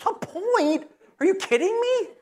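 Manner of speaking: high incredulous protest; mock outrage at the gate; outraged disbelief. Man outraged at one point.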